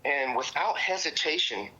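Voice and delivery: ordinary talk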